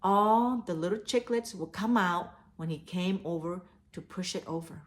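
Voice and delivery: ordinary delivery, nothing marked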